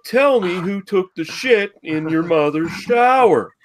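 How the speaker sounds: mock talk-show threat. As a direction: threaten the boy with a grin. Acting Dr Phil, laughing.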